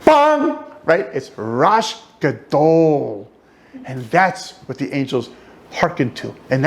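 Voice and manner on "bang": high, loud mock bang; a booming bang sound. Shown as a demonstration. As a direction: hit it like a big bang. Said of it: "not just a little ding".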